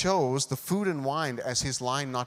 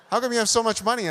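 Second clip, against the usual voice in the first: high, louder mock-girl question; girls imitated; 9 semitones higher, 6 dB louder, faster than usual. Girls asking a teen about his pay, loud high imitation. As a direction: pitch it right up, imitating impressed girls. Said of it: "girls used to say".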